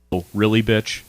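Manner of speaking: ordinary talk, nothing marked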